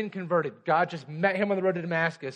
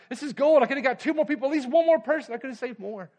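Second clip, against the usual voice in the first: higher, louder anguished plea; desperate Schindler; 9 semitones higher, 5 dB louder than usual. Retold or imitated. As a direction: cry out his regret. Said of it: "he said".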